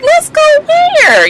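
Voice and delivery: very high, drawn-out echo; mock-screamed question. Recapper answering the drunk bartender, mock high squeal. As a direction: squeal it back, stretched and incredulous.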